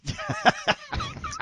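laughing. Real, not acted. Reacting to the creepy voice.

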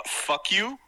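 mock defiant. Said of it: "but I will now say it".

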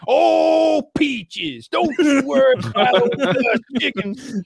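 put-on high, loud old-timer holler; Gus Chiggins. Prospector reassuring the doubting soldiers, high and hammed-up. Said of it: "an old prospector".

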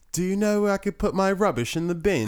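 put-on high British kid's voice; imitated British accent. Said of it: "That was a good accent".

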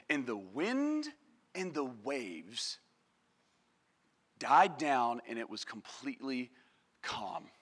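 slow, low, reverent hush; quiet, deep storytelling, fading to almost nothing; hushed calm. Acted. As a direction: let it die away softly with long pauses. Storm dies down to calm.

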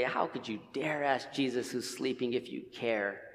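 half-whispered scolding of the disciples; hushed mock accusation. Preacher mocking the disciples for doubting Jesus, in a hushed voice.

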